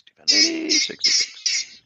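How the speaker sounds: high sing-song bingo call; bingo caller. Shown as a demonstration. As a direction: belt it out like the bingo hall. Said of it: "Did you say it like that".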